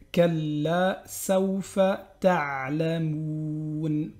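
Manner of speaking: chanted Hatta zurtumul maqabir, very slow; drawn-out chanted verse; slow recitation. Shown as a demonstration. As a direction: recite it like a Quran reader. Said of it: "le lam ici il est doublé".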